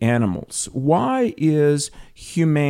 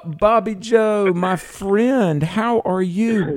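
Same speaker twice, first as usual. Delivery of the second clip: high, drawn-out hearty welcome; delighted greeting; 9 semitones higher, much slower than usual. Real, not acted. Radio host welcoming a caller he knows, delighted greeting.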